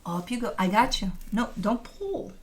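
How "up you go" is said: normal talking voice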